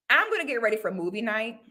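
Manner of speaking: usual speaking voice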